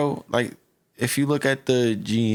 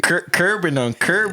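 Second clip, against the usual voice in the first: higher Memphis accent with heavy R vowels; 6 semitones higher than usual. Shown as a demonstration. Showing how Memphis people talk, Memphis accent. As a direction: lean on the Memphis "ur". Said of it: "That's how the Memphis people talk".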